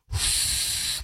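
soft unvoiced exasperated puff; blowing air out. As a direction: let the air out in quiet frustration. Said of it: "he blows air out, and he goes".

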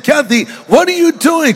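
hammed-up shocked husband yelling at his wife; outraged cry. Husband yelling at wife after she shoots mule, mock outrage. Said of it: "I was outraged".

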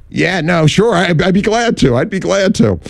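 high, overeager agreement; eager. Retold or imitated. Eager yes to the job offer.

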